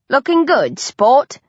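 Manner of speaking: put-on hammy Australian accent flattering her own reflection; bad Australian accent. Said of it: "I drawl in a terrible Australian accent".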